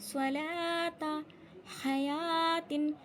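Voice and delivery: slow, measured chant; recitation demo. Shown as a demonstration. Teacher showing where alif is silent, slow recitation. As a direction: draw out the long vowels. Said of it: "वाव को नहीं पढ़ा जाएगा".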